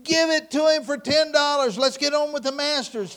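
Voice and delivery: loud, high heckle; impatient shout. Acted. Crowd member fed up with waiting, shouting impatiently. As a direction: yell to hurry the sale along.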